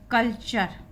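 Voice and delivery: "कल्चर" as kal-char, firmly; two clear syllables in "कल्चर", ch starting the second. Shown as a demonstration. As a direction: model "कल्चर" with ch for TURE. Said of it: "उसका उच्चारण चा करते हैं".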